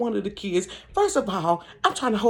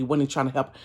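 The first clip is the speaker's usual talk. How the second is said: ordinary talk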